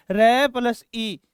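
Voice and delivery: र plus ई drawn out very slowly, each part apart; slow spelling out. Shown as a demonstration. Teacher breaks री into its parts, very slowly. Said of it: "छोटी री का मतलब क्या है?".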